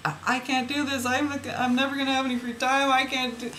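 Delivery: high-pitched imitation of overwhelmed worrying; overwhelmed complaint. Mother recalling her thoughts about her son, overwhelmed whine. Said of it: "what I was thinking with my son".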